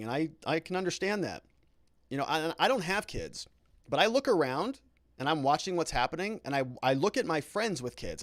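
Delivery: ordinary talk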